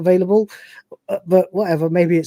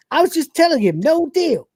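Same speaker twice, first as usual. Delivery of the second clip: higher cocky Cat voice; cat breaking character; 10 semitones higher than usual. Retold or imitated. Cat refusing the trade in his own higher voice. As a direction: snap back high and smug. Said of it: "he goes".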